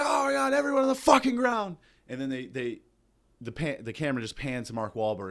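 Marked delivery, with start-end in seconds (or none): 0.0-1.8 sudden high furious shout; terrorist yell. Retold or imitated. Comic imagining terrorists storming the plane, screaming high. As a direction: scream the order like a hijacker. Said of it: "so they bust in and like".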